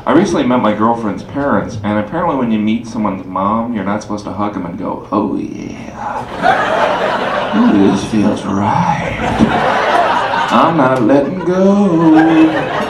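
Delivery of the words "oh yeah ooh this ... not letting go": lustful hug. Retold or imitated. Hugging girlfriend's mom.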